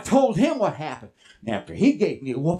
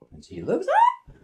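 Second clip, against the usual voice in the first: high looking up. Acted. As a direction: leap very high on up. Horse looking up, acted by pitch.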